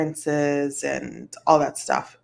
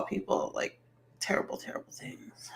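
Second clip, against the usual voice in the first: whisper; 13 dB quieter than usual. Real, not acted. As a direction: whisper terrible, terrible things, unvoiced. Whispers about cruel comments.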